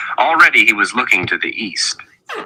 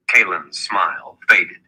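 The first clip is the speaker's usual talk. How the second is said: usual speaking voice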